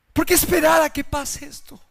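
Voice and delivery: ordinary talk, nothing marked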